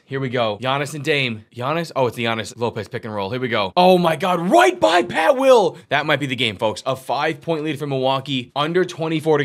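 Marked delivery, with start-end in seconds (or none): 3.8-5.8 loud, climbing into a high stretched shout; thrilled outburst. Real, not acted. Commentator as Giannis beats Pat Will, thrilled outburst. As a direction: shout the drive, drawing it out. Late driving basket.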